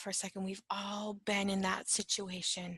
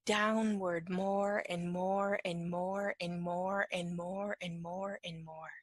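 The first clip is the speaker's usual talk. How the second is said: slow, evenly repeated, fading away; slow descending repetition; 2 semitones lower, slower than usual. Shown as a demonstration. Teacher shows anger spiralling downward, slow and fading. Illustrates a long downward escalation.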